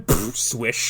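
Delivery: ordinary talk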